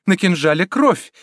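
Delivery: high, alarmed exclamations; urgent, high-pitched alarm; frightened. A narrator's reading. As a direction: protest in fright. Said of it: "жестом испуга указывала".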